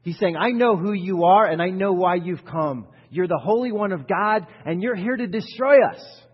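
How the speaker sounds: loud, high furious demon shouting at Jesus; demon yelling. Acted. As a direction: yell it like a possessed man disrupting worship. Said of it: "he's saying stuff like this".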